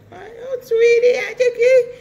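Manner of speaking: put-on high, loud, slow sugary baby talk; cooing at a baby in a high, slow voice. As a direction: coo it like a doting parent. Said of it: "You talk to your baby like".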